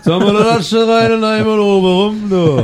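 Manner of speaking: hammed-up Kölsch singsong; Cologne accent. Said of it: "Oder man macht so Kölsche".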